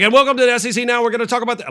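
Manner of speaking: loud, high, rushed show intro; hammed-up rapid-fire host intro; fast TV opening. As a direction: blurt the welcome at full speed, way up high. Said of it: "I'm just gonna talk fast".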